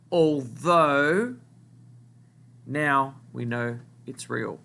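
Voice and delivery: slow, phrase by phrase, with long pauses; slow dictation. Shown as a demonstration. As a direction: read it out slowly so the class can write. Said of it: "word that you need to write down".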